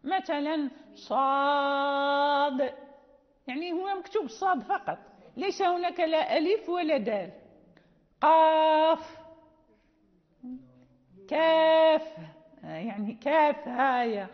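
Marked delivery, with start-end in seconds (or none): 0.9-2.7 صاد with a very long held aa; disjointed-letter recitation. Shown as a demonstration. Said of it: "هذه حروف غير موجودة ونقرأها". 8.2-9.2 قاف loud, with a very long level aa; disjointed-letter recitation. Shown as a demonstration. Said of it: "فهذه كلها نجدها في اللفظ". 11.3-12.2 كاف loud, with a long level held vowel; disjointed-letter recitation. Shown as a demonstration. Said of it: "فهذه كلها نجدها في اللفظ".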